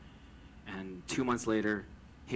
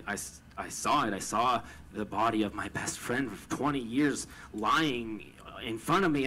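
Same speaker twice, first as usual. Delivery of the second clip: ordinary talk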